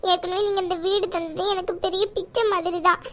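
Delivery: ordinary talk, nothing marked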